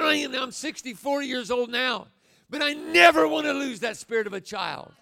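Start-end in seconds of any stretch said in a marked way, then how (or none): 2.5-4.9 high, loud declaration; loud preaching. Real, not acted. Preacher to congregation, loud and high. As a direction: lift it up and declare it.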